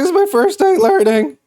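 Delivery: high-pitched flustered excuse; squeaky-high mock self-defence; mock pleading protest. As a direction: plead like a flustered learner driver. Protests to honking drivers.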